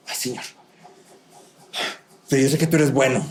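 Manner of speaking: mock a dutiful, not very genuine prayer; hushed "Ay, Señor", pause, then a perfunctory close; half-hearted prayer. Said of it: "Esa no es una oración muy genuina".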